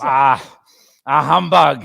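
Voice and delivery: a hint of a scoffing miser; grumpy Scrooge. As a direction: dismiss Christmas with a grunt.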